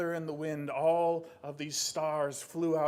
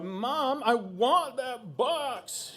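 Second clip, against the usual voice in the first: put-on high, loud, slow teen demand; whining teenager; 8 semitones higher, 5 dB louder, much slower than usual. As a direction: nag Mom like a stroppy teenager. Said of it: "he's like a teenager".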